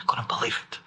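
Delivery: breathless, whispered disbelief; whispered amazement; awed whisper. Real, not acted. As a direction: hush it in wonder. Awed reply to hypnotist.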